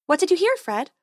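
high bright fairy voice; sweet high tooth fairy. Acted.

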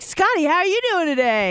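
high-pitched perky greeting; mock chirpy host. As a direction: imitate a bubbly radio co-host. Voicing radio hosts greeting Scotty.

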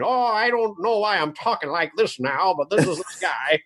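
lightly put-on twenties old man. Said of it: "a twenties old man".

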